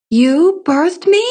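high, slow, rising question; astonished cry. A narrator's reading. Child learning the Tanner is the mother, astonished cry. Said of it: "I cried".

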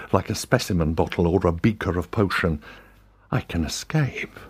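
ordinary delivery

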